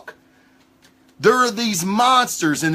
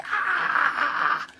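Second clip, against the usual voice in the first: sudden high mock shriek of a possessed man; demon shriek; 10 semitones higher, 6 dB quieter than usual. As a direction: let out a short demonic cry. Imitating demon entering a body.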